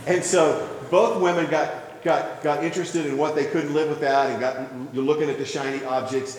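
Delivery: ordinary talk, nothing marked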